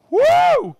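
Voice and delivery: high loud cheerful whoop; excited whoop. Real, not acted. Host whooping at a viewer from Iceland. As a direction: cheer for the shout-out.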